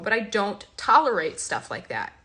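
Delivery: usual speaking voice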